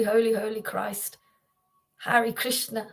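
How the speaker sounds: ordinary delivery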